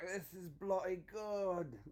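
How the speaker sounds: high, slow mock Scottish; Scottish accent. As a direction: stretch it out in hammed-up Scottish. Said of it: "You'll be able to talk Scottish".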